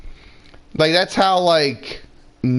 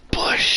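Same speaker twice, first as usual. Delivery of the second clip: beer can hiss. Retold or imitated. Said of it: "he cracked a beer and go".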